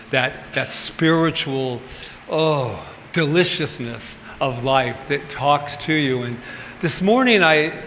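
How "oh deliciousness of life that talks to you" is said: ordinary talk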